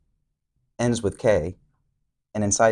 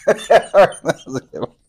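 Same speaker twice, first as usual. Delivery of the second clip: slow, laughing, louder and higher; 10 semitones higher, 10 dB louder, slower than usual. Real, not acted. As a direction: laugh through the praise. Laughing at partner's joke.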